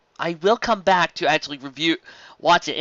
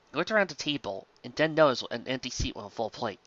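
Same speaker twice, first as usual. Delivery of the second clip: ordinary delivery, nothing marked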